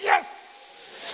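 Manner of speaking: very high, breathy cry of triumph; triumphant yes. Retold or imitated. Actor whoops after a fan mistakes him for English, triumphant. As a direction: whoop it like you just won. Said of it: "And I was just like".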